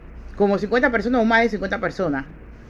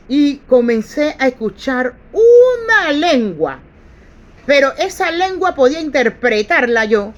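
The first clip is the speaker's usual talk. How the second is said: loud and higher, slow at first, rising in fervour; fervent preaching; 4 semitones higher, 6 dB louder than usual. Acted. Preacher telling the church how he heard the tongue, loud and fervent. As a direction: preach it like a revelation breaking through. Climax of the testimony.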